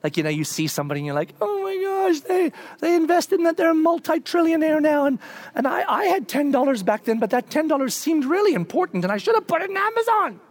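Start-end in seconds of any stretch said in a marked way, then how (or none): 1.4-10.4 high, incredulous "oh my gosh" of regret; envious mock wail. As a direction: moan about the missed fortune, pitched way up. Envying someone who invested early.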